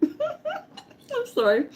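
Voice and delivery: high, slow laughing apology. Real, not acted. Apologising to viewers for eating, laughing. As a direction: squeak it out through a laugh.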